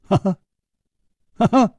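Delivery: two high bursts of ha-ha; involuntary laugh. A narrator's reading. Hero is taken aback by the killer's guess, startled high laughs. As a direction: burst out laughing despite yourself. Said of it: "失笑说".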